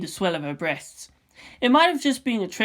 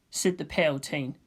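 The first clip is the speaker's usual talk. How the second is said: ordinary delivery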